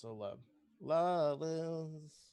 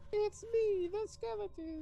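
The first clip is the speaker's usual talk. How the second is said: squeaky high-pitched skeleton; tiny voice, pitched way up; high baby voice. Acted. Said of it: "Come on, high baby voice".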